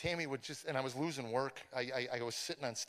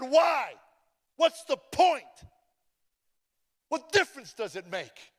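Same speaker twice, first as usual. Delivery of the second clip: despairing shout; 11 semitones higher, 11 dB louder, slower than usual. Retold or imitated. His despairing retort.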